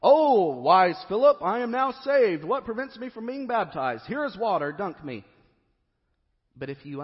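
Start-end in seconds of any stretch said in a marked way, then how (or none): 0.0-5.2 loud, high, grand mock proclamation; preacher mocking the eunuch's cocky reading, loud and grand; triumphant eunuch. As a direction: ham it up like a smug convert. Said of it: "like the eunuch is saying".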